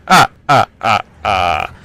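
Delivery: staccato laugh, falling in pitch; spaced-out "ah ah" victory cackle; gloating mock laugh. Gloats after pwning the noob.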